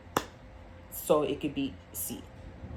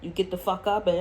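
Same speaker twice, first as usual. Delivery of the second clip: normal talking voice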